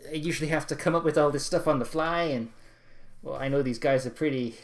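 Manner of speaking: loud put-on shopkeeper voice; Cutemon's accent. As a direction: bluster through it like a slick dealer. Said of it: "I can't do his accent anymore".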